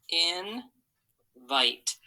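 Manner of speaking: teacher sounding out Invite syllable by syllable. Shown as a demonstration. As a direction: Invite split slowly in two beats. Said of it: "draw a line for each syllable".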